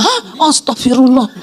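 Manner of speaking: mock startled gasp, then dropping; retold shock. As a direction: imitate a stunned officer's exclamation. Retold officer learns family was aboard.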